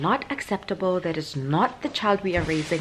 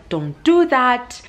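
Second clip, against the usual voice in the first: put-on loud, slow warning to a child; sharp scold; 6 semitones higher, 6 dB louder, slower than usual. Mom telling her daughter off about props, loud and slow. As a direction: snap the warning out slowly.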